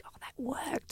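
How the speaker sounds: hushed whisper of relief; unvoiced whisper, almost to herself. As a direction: breathe it out like a private thought. Quiet relief at a payoff.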